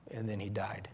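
quiet heavy sorrow; hushed grief. Real, not acted. Grave pause after death.

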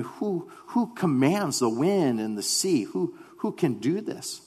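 slow, softly acted wonder; hushed amazement. Disciples retell the calming of the sea, amazed. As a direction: marvel slowly, almost under your breath.

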